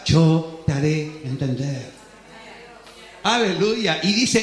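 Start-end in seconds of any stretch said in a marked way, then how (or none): 0.0-1.9 much lower, deep calm divine voice; solemn final divine promise; voice of God. Acted. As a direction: close it as God, grave and sure. Pero dice Dios.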